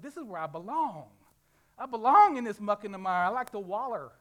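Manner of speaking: loud, contented pig voice; happy pig. Retold or imitated. Preacher voices a pig loudly happy in the mire. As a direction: revel in the mud, loudly. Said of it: "He's like".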